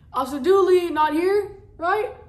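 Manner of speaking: high, loud call across the room; loud hail, ending in a rising question. Acted. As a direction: shout to check someone is absent. Calls out to officer in audience.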